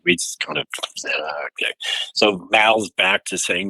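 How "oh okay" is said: small, whispered letdown; deflated whisper. Retold or imitated. Band mates whisper back to the guide, let down. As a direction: barely voice it, crestfallen.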